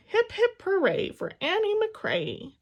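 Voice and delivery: high, loud, slow cheer. A narrator's reading. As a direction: cheer her on big and high. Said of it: "he cheered as he always did".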